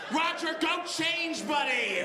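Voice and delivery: high, drawn-out mock shout; exasperated shout. Coworker telling Roger to go change, exasperated shout. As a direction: call it out slowly in comic exasperation. Acted fed-up colleague calling out.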